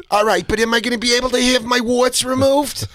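imitated caller pushing to get his warts removed; Saul Rosenberg. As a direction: pester her like a fussy old prank caller. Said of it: "his first character is Saul Rosenberg".

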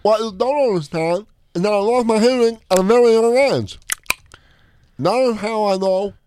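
put-on voice mocking Vicky. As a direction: imitate her garbled way of talking. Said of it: "She goes".

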